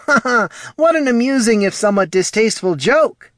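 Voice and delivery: high, loud fake laugh, then hurried protest; loud forced joviality. A narrator's reading. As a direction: loudly laugh off a tasteless joke. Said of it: "said Poldo loudly".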